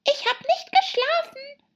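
very high, strained little dormouse; high, hoarse sleepy creature; weak, hoarse voice. A narrator's reading. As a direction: squeak it out hoarsely. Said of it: "mit schwacher, heiserer Stimme".

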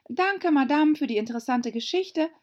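put-on high, quick girlish voice; polite young Alice. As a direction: chirp a hurried polite thanks. Alice's imagined polite farewell. (hohe, eilige Alice-Stimme)

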